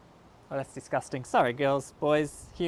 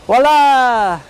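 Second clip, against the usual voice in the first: loud, high magician's flourish; triumphant voila; 4 semitones higher, 14 dB louder than usual. Acted. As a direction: announce the reveal with flair.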